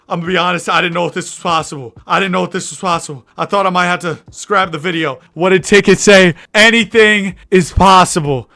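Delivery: loud, high excitement; elated amazement. Real, not acted. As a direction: marvel at an unexpected win. Amazed by the win.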